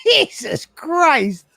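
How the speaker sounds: slow, high, crying shout; real shocked outcry; anguished exclamation. Real, not acted. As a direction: drag it out in horrified disbelief. Shocked reaction.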